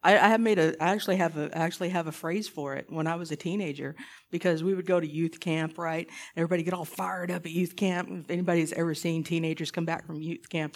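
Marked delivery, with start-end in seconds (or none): none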